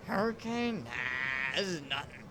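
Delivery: dismissive scoff in the uncle's voice. A narrator's reading. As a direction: brush it off like an old salt. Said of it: "My uncle scoffs".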